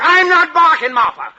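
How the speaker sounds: loud, high, slowing on the last words; barking shout. Acted. Husband barks at his wife that he doesn't bark. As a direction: bark the denial back at her. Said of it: "don't bark".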